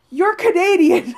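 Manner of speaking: put-on laughing at British-sounding kids in Canada; incredulous retort. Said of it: "it's just like".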